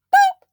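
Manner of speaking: sudden high loud boop; mock fanfare boop. Mocking the sidewalk bed with a boop. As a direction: pop out a bright mock boop.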